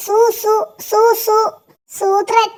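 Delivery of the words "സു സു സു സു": slow, stammered സു സു സു; syllable drill. Shown as a demonstration. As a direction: break it into syllables slowly. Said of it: "സൂത്രനല്ലടാ". (സു എന്ന അക്ഷരം പതുക്കെ ആവർത്തിക്കുന്നു)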